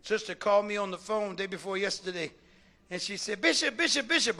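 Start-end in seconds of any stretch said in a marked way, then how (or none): 3.4-4.4 mildly voiced urgent repeated calling; excited caller. Imitated sister phones her bishop, all excited. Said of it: "all excited".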